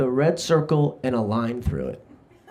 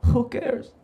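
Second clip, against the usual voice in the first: put-on high-pitched Serbian-accented punchline; dismissive, in a Serbian accent; 10 semitones higher than usual. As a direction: deliver it as a Serbian cabbie. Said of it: "that's a Serbian accent".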